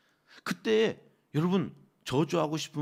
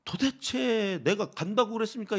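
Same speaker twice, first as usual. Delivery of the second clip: exasperated, higher-pitched protest; worked-up indignant question; aggrieved Moses complaining; 7 semitones higher, 3 dB louder than usual. Acted. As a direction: demand an answer from God. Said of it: "하소연을 하는 모세의 마음이".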